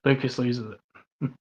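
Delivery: deadpan slow caption read; mock dramatic title. Shown as a demonstration. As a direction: drop it like a dramatic trailer tag.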